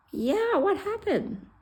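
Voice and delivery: high, drawn-out, put-on voice; mock-cheerful reply. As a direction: chirp it as if nothing happened. Retold casual reply after terrifying event.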